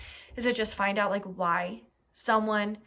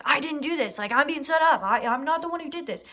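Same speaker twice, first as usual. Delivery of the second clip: higher, louder, indignant protest; defiant denial; 6 semitones higher, 5 dB louder than usual. Retold or imitated. Killer answering the victim's family in court, higher, indignant denial. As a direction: insist you're innocent, pushing back hard. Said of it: "answers back and says".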